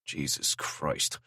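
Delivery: soft, slow, breathy exasperated curse; muttered. Acted. Drew muttering after Zara tells him to apologise. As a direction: mutter it under your breath.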